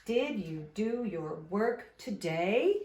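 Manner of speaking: drawn-out question, TODAY sliding upward; slow model question, voice climbing at TODAY; slow question rise. Shown as a demonstration. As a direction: say it slowly and lift the voice on TODAY. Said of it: "my voice is going up at the end".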